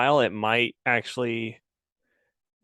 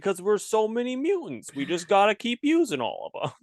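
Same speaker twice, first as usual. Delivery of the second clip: much higher, hammed-up cartoon excuse; X-Men announcer voice; 10 semitones higher than usual. Co-host spoofing why obscure mutants return, high announcer voice. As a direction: pitch it way up like a pleading cartoon announcer. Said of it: "your X-Men announcer voice".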